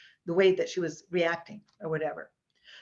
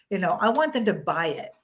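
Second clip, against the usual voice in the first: usual speaking voice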